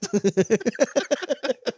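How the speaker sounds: a burst of high-pitched laughter. Real, not acted. Father laughing at his own joke about his son, high burst of laughter.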